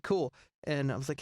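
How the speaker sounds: ordinary talk, nothing marked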